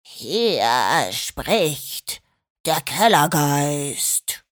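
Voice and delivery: low, slow, booming cellar ghost; deep ghost voice. Acted. Schorsch spooks the dognappers over the walkie-talkie, low slow booming ghost. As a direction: drop the voice as deep as it goes and drone slowly. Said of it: "senkte die Stimme so tief, wie er nur konnte".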